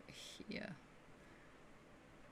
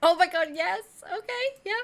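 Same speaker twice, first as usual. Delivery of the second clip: high, loud delighted outburst; real excitement; 12 semitones higher, 9 dB louder than usual. Real, not acted. Game master cheering the player's hit, real excitement. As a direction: burst out thrilled at the roll.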